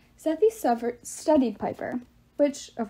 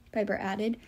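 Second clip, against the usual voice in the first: usual speaking voice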